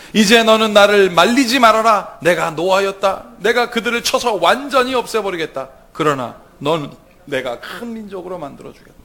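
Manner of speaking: preacher voicing an angry God; God's voice, preached. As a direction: thunder out the Lord's anger. Reads God's words.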